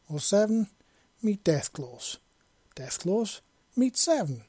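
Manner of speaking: high, nervous introduction with jumps in pitch; alarmed and fearful. A narrator's reading. Robot introduces Seven to the Deathclaws, alarmed and fearful. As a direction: make tense introductions, voice jumping up in alarm. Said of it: "equally alarmed and fearful voice".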